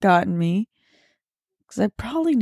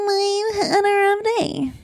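high, whiny fake sob; mock crying; 10 semitones higher than usual. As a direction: wail it as a hammed-up pity bid. Mock wailing after rough day chant.

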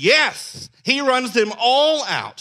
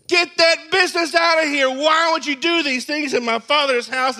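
angry shout; 6 semitones higher than usual. Shown as a demonstration. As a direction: throw them out with force. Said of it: "what I read is not this".

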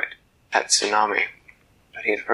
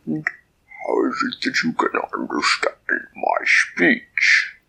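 deep kraken voice; 7 semitones lower than usual. Acted. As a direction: speak like a vast creature from the deep. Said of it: "in that deep underwater voice".

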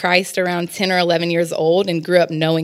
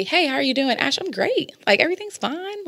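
much higher, bright put-on cheerful greeting; fake cheerful; 8 semitones higher, 4 dB quieter than usual. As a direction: pretend everything's fine, chirpy and high. Fake cheerful greeting, acting fine.

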